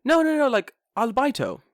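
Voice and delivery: high, loud repeated correction; insistent teacher imitation. Teacher insisting albaito is English, high loud imitation.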